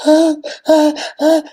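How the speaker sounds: loud sobbing wail, pitch up; crying wail. Shown as a demonstration. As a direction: wail out a choked cry. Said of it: "the kind of cry you cry".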